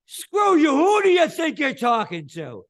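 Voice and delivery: very high, loud blowhard shout; arrogant congressman yelling. Retold or imitated. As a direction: scream it like a puffed-up politician.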